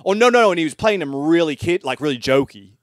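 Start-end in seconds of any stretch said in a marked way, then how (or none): 0.0-0.5 loud, high "oh no, no, no!"; emphatic refusal. Retold or imitated. Director turning down the slapstick take, loud and emphatic. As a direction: wave the idea away hard. Retelling the director rejecting the role.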